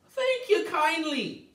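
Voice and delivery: high, slow little-man voice; high leprechaun voice. Acted. The leprechaun answering Tom, high and slow. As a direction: squeak it out like a tiny old man.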